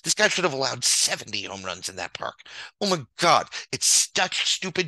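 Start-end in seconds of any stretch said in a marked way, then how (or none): 2.8-4.9 louder, higher cry of disbelief; exasperated outburst. Real, not acted. Ranting to viewers about stupid GMing, a louder exasperated outburst. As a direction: blurt it out, fed up.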